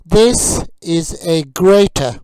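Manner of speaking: slow, loud, carefully clear teacher drill; vocabulary drill. Acted. Teacher drilling kitchen words for learners, slow and clear. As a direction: model each word for learners to repeat.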